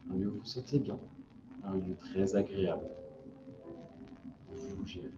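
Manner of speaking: soft, calm relaxation voice; guided meditation. Acted. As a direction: ease them into stillness.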